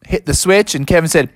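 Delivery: usual speaking voice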